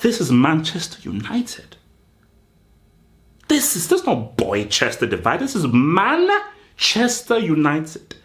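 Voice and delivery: hammed-up defiant protest; defiant outburst. Mock manager voicing players standing up, defiant. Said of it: "stand up and say".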